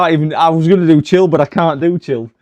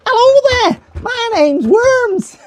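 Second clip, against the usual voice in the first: squeaky high mock voice, slow and laughing; imitating Worms; 6 dB louder, much slower than usual. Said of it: "I think Worms talks like this".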